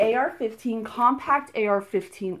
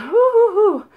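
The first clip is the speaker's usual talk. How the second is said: out of breath; 13 semitones higher, 9 dB louder, slower than usual. Real, not acted. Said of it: "I just ran up the stairs and I'm out of breath".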